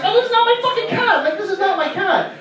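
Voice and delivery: high, fast, fed-up denial; hammed-up outrage at strangers' cats; mock annoyed friend. Buddy swatting away strangers, hammed-up exasperated high voice. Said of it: "he would be like".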